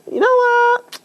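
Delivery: high sudden call over the shoulder; calling back. Acted. Rescuer turning back to the father, calling out high. Calls back to him while leaving.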